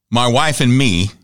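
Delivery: ordinary talk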